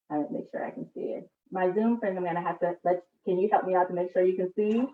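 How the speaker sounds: ordinary talk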